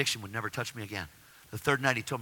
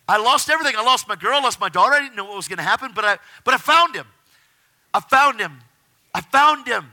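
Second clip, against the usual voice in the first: loud, high, building fervor; impassioned testimony; 9 semitones higher, 10 dB louder than usual. Real, not acted. Telling how he lost everything, impassioned testimony. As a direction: proclaim it at full emotional force.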